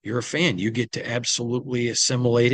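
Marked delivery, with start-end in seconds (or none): none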